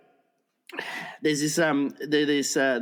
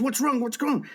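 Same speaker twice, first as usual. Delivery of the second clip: much higher, urgent doctor; alarmed questioning; 12 semitones higher than usual. Acted. Doctor to a flustered man outside the room, alarmed. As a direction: fire off a high, worried question.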